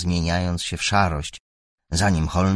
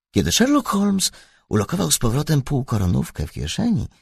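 ordinary delivery, nothing marked

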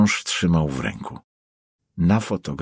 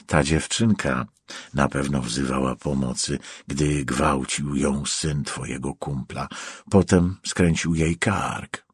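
low, soft, measured accusation; cold avenging stranger; 6 semitones lower, 5 dB quieter than usual. Acted. Stranger confronting the trapped prosecutor, coldly accusing. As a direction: keep it low and controlled, letting it weigh.

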